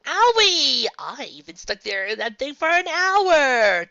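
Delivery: high, loud, slow whine; high-pitched childish whining. Acted. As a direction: whine like a hurt brat. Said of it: "Demikins whined".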